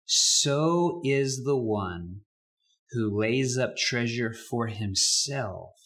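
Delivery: slow, solemn reading of the verse; slow scripture reading. Acted. Pastor closing the reading for the congregation, slow and solemn. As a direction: let each phrase land.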